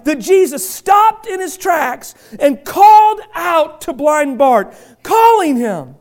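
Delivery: high, full-force preaching; fervent preaching. Acted. Pastor driving home the call to the church, fervent preaching. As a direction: preach it with rising fire.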